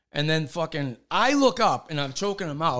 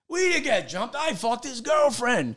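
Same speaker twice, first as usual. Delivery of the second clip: idiot blurting; 9 semitones higher than usual. Retold or imitated. Teller mocking himself blurting to the cops.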